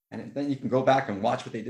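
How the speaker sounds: ordinary talk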